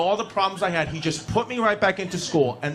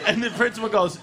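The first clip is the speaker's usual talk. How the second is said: ordinary talk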